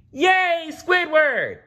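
high, loud cartoon cheer; cheering Yay. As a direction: clap and cheer. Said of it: "they said".

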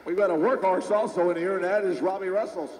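live play-by-play. Real, not acted. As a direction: call the run as it happens.

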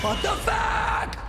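startled high slow shout; jump-scared, yelling in shock; scared horror game streamer. Real, not acted. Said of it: "She just fucking jump scared me".

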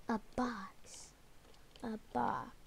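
breathy whisper of realization. Real, not acted. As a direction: whisper it under your breath. Learns the hiding spot.

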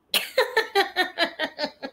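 long, high giggling laugh; real laugh. Real, not acted. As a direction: laugh in a run of high bursts. Laughing at something on screen.

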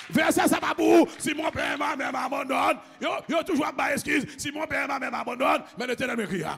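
loud, high, driving preacher's shout; full-force preaching. Real, not acted. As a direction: hammer the verse out to the congregation.

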